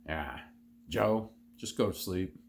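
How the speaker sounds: ordinary talk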